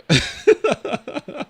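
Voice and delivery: high-pitched falling laugh. Real, not acted. Streamer laughing after teasing chat, high laugh.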